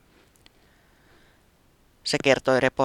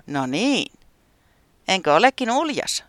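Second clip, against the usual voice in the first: higher, louder, pleased with himself; smug weatherman; 7 semitones higher, 6 dB louder than usual. Acted. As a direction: show off a proud pose, a touch smug. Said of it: "se sanoi tyytyväisenä".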